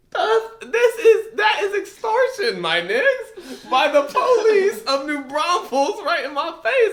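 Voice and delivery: high, laughing disbelief; incredulous laughing rant; laughing outrage. Real, not acted. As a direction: crack up while calling it extortion.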